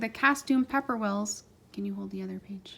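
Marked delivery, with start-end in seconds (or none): 1.7-2.8 hushed unvoiced request to the page-holder; whispered aside. Real, not acted. Parent whispering to child holding the book page. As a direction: whisper it so the story isn't broken.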